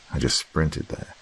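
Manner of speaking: usual speaking voice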